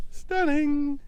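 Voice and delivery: high delighted exclamation; amazed. Real, not acted. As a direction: gasp it in wonder. Admiring sand colours.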